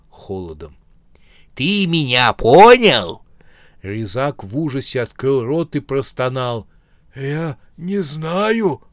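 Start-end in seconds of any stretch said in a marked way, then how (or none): 1.6-3.2 slow, loud threat; menacing corpse. Acted. Corpse threatens the bandit face to face. 7.2-8.8 whispered in fear. Acted. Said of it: "прошептал бандит".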